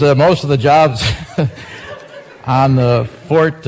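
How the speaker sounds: breaking into laughter mid-headline, words slowed; can't get through the line for laughing; cracking up. Real, not acted. Radio newsman cracking up reading a joke headline, laughing. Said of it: "I have tears in my eyes".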